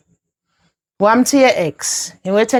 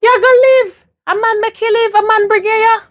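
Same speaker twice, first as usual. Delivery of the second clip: much higher-pitched, emphatic exhortation; impassioned insistence; 8 semitones higher than usual. Acted. Mother insists her daughter live despite a man, impassioned. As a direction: insist fervently, pitch raised high.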